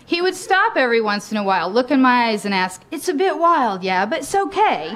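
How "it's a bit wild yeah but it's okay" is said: lightly imitated Cockney, lifting then dropping; touch of a Cockney rock star; Cockney lover. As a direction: check in with a hint of Cockney. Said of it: "in his thick Cockney accent".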